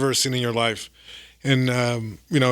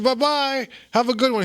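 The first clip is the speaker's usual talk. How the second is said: high, singsong goodbye; chummy farewell; 9 semitones higher than usual. Retold or imitated. Comic parting from his elderly plane friend, high singsong farewell. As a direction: imitate a friendly goodbye pitched way up.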